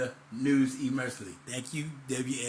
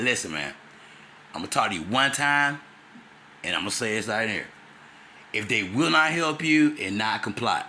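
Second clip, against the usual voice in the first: lower mentor voice; 6 semitones lower, 4 dB louder than usual. Retold or imitated. Quoting his mentor's advice.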